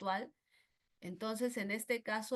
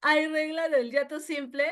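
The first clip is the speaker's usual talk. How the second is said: raised, louder voice of a puzzled student; student's question; 6 semitones higher, 8 dB louder than usual. Retold or imitated. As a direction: ask it like a confused pupil. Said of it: "profesora, ¿cuál es la regla". (alumna que pregunta en voz alta)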